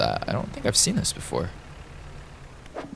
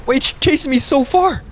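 frantic high shouting; scared horror game streamer; 4 dB louder, slower than usual. Real, not acted. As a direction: scream as it keeps chasing you. Serpent still chasing.

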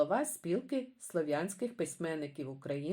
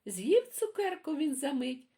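put-on lively sing-song poem reading for kids; reading poems to children; 3 semitones higher than usual. A narrator's reading. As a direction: read it like a bedtime rhyme for little ones. Reads children's poems aloud.

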